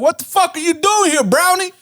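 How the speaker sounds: high-pitched mocking heckle; hostile drunk guys. Drunk guys confronting the stranger in their yard, high hostile jeer. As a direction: imitate a drunk guy challenging a stranger, high.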